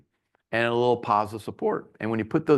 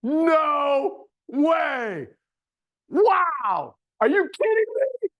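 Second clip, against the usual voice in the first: high, loud, over-the-top amazement; surprise demo; 12 semitones higher, 3 dB louder, much slower than usual. Shown as a demonstration. Webinar host demoing surprise to attendees, over-the-top amazement. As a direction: ham up shock at a highlighter. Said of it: "Here, my surprise would be".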